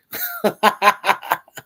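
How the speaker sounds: bursts of real laughter at a chat joke. Real, not acted. Host cracking up at a viewer's joke, laughing. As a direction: laugh out loud at the comment. Reading a funny chat comment.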